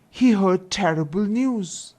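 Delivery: ordinary delivery, nothing marked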